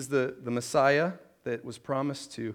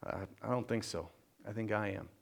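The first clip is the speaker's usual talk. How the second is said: humble admission; 6 semitones lower, 11 dB quieter than usual. Acted. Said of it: "follow Paul's example and go".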